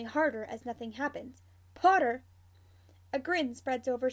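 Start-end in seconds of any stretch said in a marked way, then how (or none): 1.7-2.2 sudden loud outburst of a name; angry call. A narrator's reading. Annoyed co-worker calls out the suspected prankster, angry. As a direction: call out the culprit sharply.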